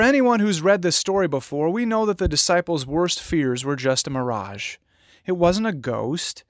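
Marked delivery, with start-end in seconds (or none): none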